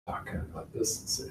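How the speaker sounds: barely audible whisper; soft voice. Shown as a demonstration. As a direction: talk so softly they can barely hear. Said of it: "give a really soft voice".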